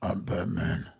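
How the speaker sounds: low, quiet gravel-free Batman growl; a hint of Batman. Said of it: "mixing in a little bit of Batman there too".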